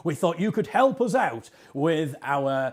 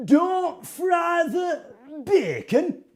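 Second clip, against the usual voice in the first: high, drawn-out Hartlepool accent; 12 semitones higher, 3 dB quieter, slower than usual. Shown as a demonstration. Showing Tim the mayor's line, high Hartlepool accent. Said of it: "in a very authentic Hartlepool accent".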